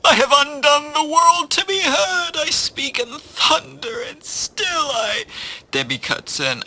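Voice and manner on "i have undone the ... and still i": high, wailing tragic poet; melodramatic tsunami. Acted. Tsunami pleading with a plumber, melodramatic wail. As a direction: lament in a high, theatrical voice. Tsunami's lofty lament, cut off.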